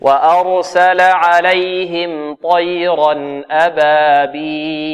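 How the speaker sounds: slow melodic chant; tajweed chant; Quranic recitation. Acted. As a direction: lead the class line by line. Recitation for class repetition.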